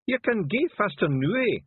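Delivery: high, loud Scottish voice, slowed right down; a gruff little Feegle in disguise barking orders; high Scots wee man. Acted. Feegle passenger voice.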